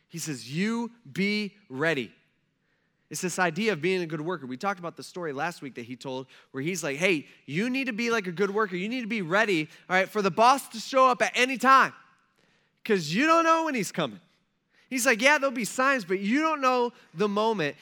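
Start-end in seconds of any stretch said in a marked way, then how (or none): 0.5-2.1 drawn out, each word weighted; slow solemn charge. Retold or imitated. Jesus charging his followers, slow and weighty. 7.0-17.8 higher and louder as it builds; rising urgent voicing. Jesus telling workers to be ready, loud and high.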